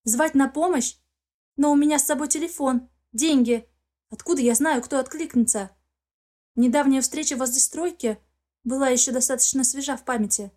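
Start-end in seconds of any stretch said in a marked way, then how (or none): none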